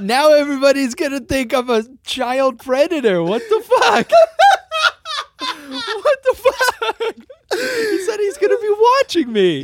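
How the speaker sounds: shrieking with laughter, high; laughing so hard it turns to crying; laughing hysterically. Real, not acted. Stunned by the Chris Hansen video.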